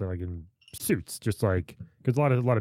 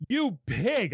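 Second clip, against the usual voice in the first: high-pitched accusing mock yell; 12 semitones higher than usual. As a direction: act out calling him out in public. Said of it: "I started yelling at him".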